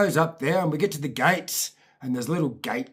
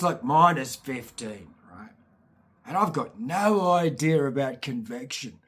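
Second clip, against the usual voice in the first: ordinary delivery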